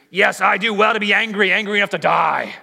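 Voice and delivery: loud, stubborn retort; defiant Jonah. Acted. Jonah talking back to God about the plant, loud defiant retort. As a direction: dig in, bristling.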